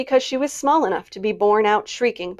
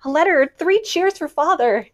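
high, loud gleeful shout; excited cry; 8 semitones higher, 5 dB louder than usual. Acted. Jo cheering news of Father's letter, excited shout. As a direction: cry out in delight.

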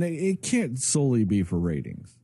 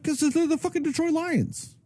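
exasperated retort. Retold or imitated. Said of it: "You're like".

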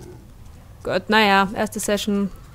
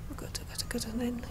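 scared whisper; 14 dB quieter, faster than usual. Real, not acted. Frightened whisper in danger.

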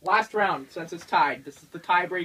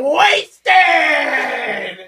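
slow, loud whiny mockery; mock daughter shout; 7 semitones higher, 9 dB louder, much slower than usual. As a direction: drag it out mockingly. Mocking daughter's plan.

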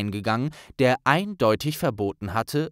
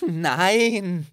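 pathetic lie; 7 semitones higher than usual. Acted. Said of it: "log Hermine erbärmlich".

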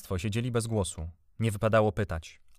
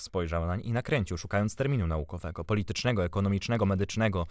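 ordinary delivery, nothing marked

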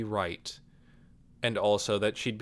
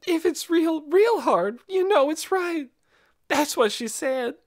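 high, tearful pleading through sobs; crying; 7 dB louder than usual. Acted. Amanda crying to Nikki, tearful high plea. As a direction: voice breaking into tears as she explains. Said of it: "she really was starting to cry".

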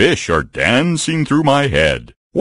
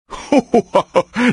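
giddy giggle; 13 semitones higher than usual. Acted. Bear giggles with joy.

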